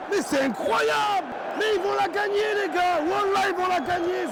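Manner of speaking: high shouted disbelief; exasperated shouting. Real, not acted. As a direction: cry out that they'll win it. Reacting live to another goal.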